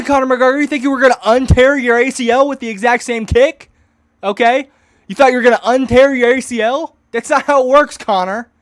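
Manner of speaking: shouted high and loud in disbelief; loud mocking outrage; incredulous shout. Real, not acted. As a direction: yell at the screen. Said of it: "reacting to fight clip".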